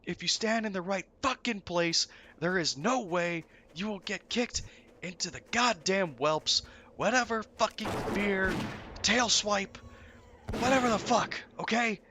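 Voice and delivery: high, loud ranting; mock tirade. Raid leader ranting at Crushing, high angry tirade. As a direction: rant at the player who messed up. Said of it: "He goes on this fucking tirade".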